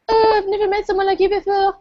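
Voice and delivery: high mock swoon; swooning damsel. Friend mocking the smitten heroine, high mock swoon. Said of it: "he's like".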